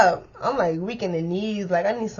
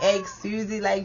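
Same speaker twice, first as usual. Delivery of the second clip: usual speaking voice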